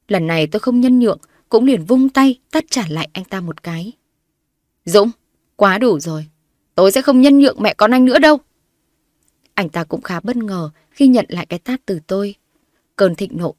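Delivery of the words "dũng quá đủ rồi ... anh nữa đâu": loud, high furious vow; wife shouts at her husband; defiant shout. A narrator's reading. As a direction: shout back after the slap. Wife slaps back, shouts.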